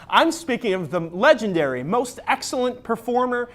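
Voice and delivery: normal talking voice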